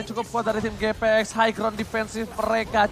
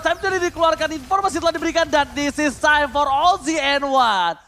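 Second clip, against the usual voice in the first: excited play-by-play; 6 semitones higher, 6 dB louder than usual. Real, not acted. Caster hypes the war charge.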